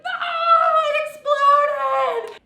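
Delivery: high, loud, drawn-out cry of dismay; dismayed shout. Retold or imitated. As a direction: wail it like the pot just blew. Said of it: "and I'd be like".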